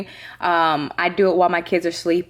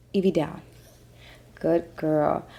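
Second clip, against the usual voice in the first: soft, low command to a dog; quiet dog command; 4 semitones lower, 6 dB quieter than usual. Real, not acted.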